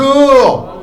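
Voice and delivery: loud, high call demanding an answer; insistent greeting. Real, not acted. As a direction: prod the crowd into greeting back. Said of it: "Come on, I said bonjour".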